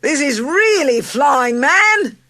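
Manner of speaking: very high, loud triumphant shouts; joyful yelling. A narrator's reading. Charlie yelling in flight to everyone, joyful. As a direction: yell with delight. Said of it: "cried Charlie joyfully".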